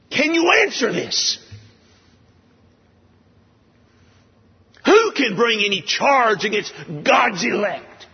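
high, ringing challenge thrown out to the universe; a preacher voicing Paul's defiant questions to unseen worlds. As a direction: dare anyone to answer. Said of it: "That's the scene, the tone".

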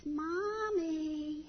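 put-on slow, small-child voice; little boy voice. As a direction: play the toddler delivering news innocently. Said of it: "he says".

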